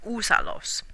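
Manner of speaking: "Úsalos" with stress on ú, falling at the end; "Úsalos" said carefully, ú stressed; model pronunciation. Shown as a demonstration. Teacher models "Úsalos" after showing the accent. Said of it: "That's why the accent is here".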